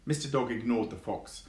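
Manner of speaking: plain reading voice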